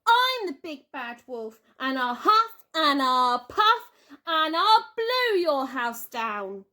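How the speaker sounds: loud, hammed-up wolf growl; playful wolf. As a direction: play the big bad wolf. Child playing wolf.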